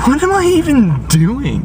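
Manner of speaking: high, drawn-out squeal at herself; a slow, high self-mocking cry; exasperated squeal. Real, not acted. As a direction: squeak it out in mock despair. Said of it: "I'm just being really weird right now".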